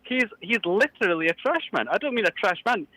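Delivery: high, indignant Scottish priest; Scottish caller ranting about an invoice; Scottish accent. Acted. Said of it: "I can't tell with your accent".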